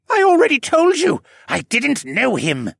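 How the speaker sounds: high, loud, whiny protest; whining. A narrator's reading. Assassin insists he didn't know his employer, whining. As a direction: whine it defensively. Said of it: "the assassin wannabe whined".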